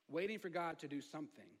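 ordinary talk, nothing marked

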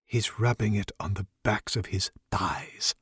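soft unvoiced whisper; whispered aside. Druid leaning closer to tell the wizard, whispered aside. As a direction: lean in and whisper the gossip. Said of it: "chimes in".

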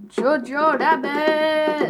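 very slow, stretched title; drawn-out reveal. Real, not acted. Drawn-out reveal of third movie.

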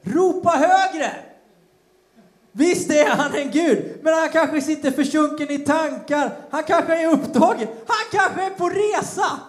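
high, loud mocking taunt, slow at the start; teasing. Elijah mocking the prophets of Baal, teasing and loud. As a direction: taunt them like a schoolyard rival. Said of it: "Då retades Elia med dem".

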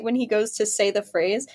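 ordinary delivery

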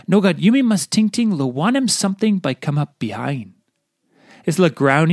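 normal talking voice

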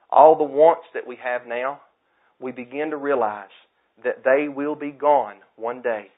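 loud, building preacher cadence; preaching at full force. Acted. Preacher warning the church about wants, loud and rising. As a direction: hammer each point like a revival preacher.

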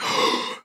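a sharp whispered gasp of realization; mock gasp. Friend recalls rewatching Wayne's World, a quiet gasp. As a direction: gasp as if the penny drops. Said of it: "and I was like".

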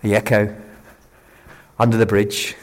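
echoing under a stone bridge. Real, not acted. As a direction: speak into the bridge's echo. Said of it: "I don't know if you can pick that up".

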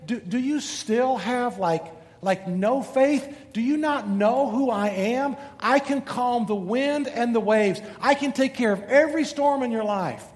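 Jesus speaking. Acted. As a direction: reassure them with calm authority. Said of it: "But Jesus says".

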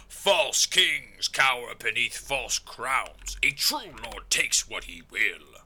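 slow, grandiose villain voice; Mordekaiser. Acted. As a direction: proclaim it as a dark lord, drawn out. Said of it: "That's true, Mordekaiser".

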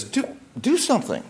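high, pleading mother's plea; a touch of an anxious mother urging her son; urging Mary. Retold or imitated. Mother urging her son to act, high pleading.

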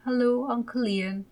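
put-on high, timid child's hello; a little boy greeting his big uncle bashfully; shy little boy. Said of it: "little Ted said shyly".

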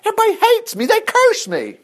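whining. Retold or imitated. Preacher imitating Jeremiah whining that everybody hates him. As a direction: whine like a sulky kid. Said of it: "he was whining".

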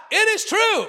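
high-pitched call of affirmation; shouting it out. Shown as a demonstration. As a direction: call it out like a congregation's amen. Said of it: "So if you shout it out".

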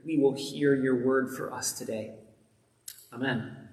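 soft, low prayer voice. Acted. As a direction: pray it quietly and reverently. Leads a prayer.

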